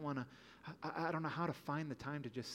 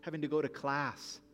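fervent preaching; 4 semitones higher than usual. Acted. Pastor stirring the congregation over their burdens, impassioned. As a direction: preach it, rising with urgency. Builds intensity listing burdens.

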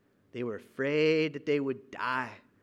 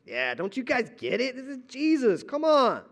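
higher, louder know-it-all scoff rising to come on; impatient mock disbelief, climbing; arrogant; 6 semitones higher, 4 dB louder than usual. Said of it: "we kinda get a little arrogant".

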